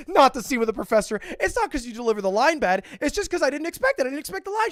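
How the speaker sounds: fast, high, panicky backpedalling; flustered excuse. Retold or imitated. Explaining his laugh to the actress, flustered and rapid. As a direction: scramble to explain, pitch climbing.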